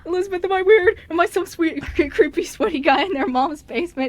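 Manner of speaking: high, loud retold panic; freaking out. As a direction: fret loudly for reassurance, mock-panicked. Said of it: "'cause I was freaking out".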